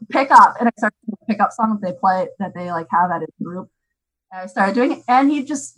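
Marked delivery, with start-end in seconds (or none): 0.1-0.5 put-on louder, clear command to a toddler; firm instruction. Shown as a demonstration. Mom teaching her son to tidy up, firm and louder. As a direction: say it firmly so the child follows. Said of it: "I showed him, like".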